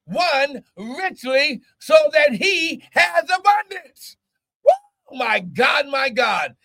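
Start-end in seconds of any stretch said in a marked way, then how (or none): 4.6-5.2 sudden high whoop of joy; ecstatic high woo. Real, not acted. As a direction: let out a joyful shriek of praise. Preacher's ecstatic outburst.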